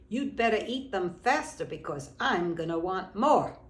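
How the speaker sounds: put-on a loud, bossy kid's demand. Bigger friend bossing the small girl, loud demand.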